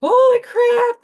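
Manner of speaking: imitating kids who found their dog, high and loud; excited kids. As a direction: squeal it like thrilled little boys. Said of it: "They're like".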